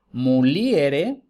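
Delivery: muliere with I and E in separate syllables; hiatus in muliere. Shown as a demonstration. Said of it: "en dos sílabas diferentes".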